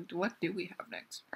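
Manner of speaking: soft whispered recap; whispering. Real, not acted. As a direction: whisper the list.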